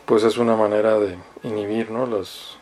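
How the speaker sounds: ordinary talk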